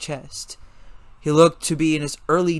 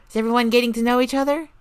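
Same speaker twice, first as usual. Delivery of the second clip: high, fast, breezy question; high fast cheery girl; chirpy girl; 10 semitones higher, 3 dB louder, faster than usual. A narrator's reading. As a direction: breeze in brightly and quickly. Melissa's chirpy voice.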